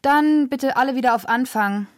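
high, projected set call; director's announcement. A narrator's reading. Director orders a restart, calling out. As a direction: call everyone back to their places. Director calls through megaphone.

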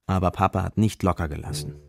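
normal talking voice

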